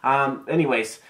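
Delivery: ordinary talk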